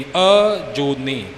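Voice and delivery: ਅਜੂਨੀ drawn out slowly, long ਊ, no doubled ਨ; slow correct model. Shown as a demonstration. Teacher shows learners ਅਜੂਨੀ, slow and careful. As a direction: stretch ਅਜੂਨੀ with a long ਊ, single ਨ. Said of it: "ਅੱਡ ਅੱਡ ਕਰਕੇ ਬੋਲਣਾ ਨਹੀਂ".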